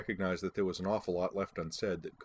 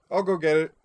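loud, high-pitched offer; raised, ready-to-go announcement; eager volunteering; 9 semitones higher, 9 dB louder than usual. A narrator's reading. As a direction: pipe up to volunteer, tipsy and keen. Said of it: "Starbuck volunteered".

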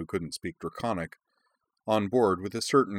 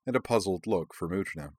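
ordinary delivery, nothing marked

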